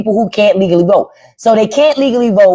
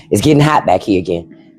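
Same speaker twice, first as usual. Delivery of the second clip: ordinary talk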